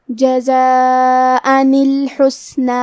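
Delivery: الحسنی read slowly with the س held still; slow drawn-out recitation of الحسنی; tajweed demo. Shown as a demonstration. Tajweed teacher modelling الحسنی for students, slow and careful. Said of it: "کلمت الحسنی کو پڑھنا ہے".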